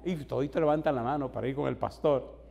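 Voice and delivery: ordinary talk, nothing marked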